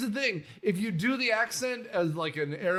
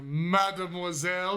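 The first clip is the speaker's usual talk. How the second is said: cartoon French flourish; silly French accent; 2 semitones lower than usual. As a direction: do an over-the-top French suitor. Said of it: "the silly accent and stuff like that".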